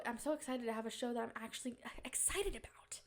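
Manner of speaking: hushed, thrilled whisper; excited whisper. Real, not acted. Confiding to viewers in an excited whisper. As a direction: whisper it like a giddy secret.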